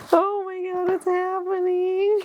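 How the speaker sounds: high, near-tears joy; tearful excitement. Real, not acted. Unboxing again, high tearful gushing. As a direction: gush with happy tears. Repeat while opening.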